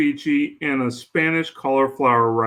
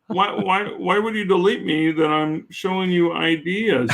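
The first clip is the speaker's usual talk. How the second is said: mock indignation; 5 semitones higher than usual. Retelling his hurt protest.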